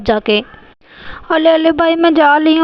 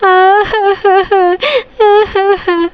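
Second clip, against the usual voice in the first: put-on child sobbing; 5 semitones higher than usual. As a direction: cry like a scolded little girl. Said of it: "ये गिन्नी रोए जा रही है".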